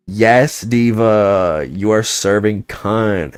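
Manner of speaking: loud, high, gushing diva cheer; sassy drag queen. Retold or imitated.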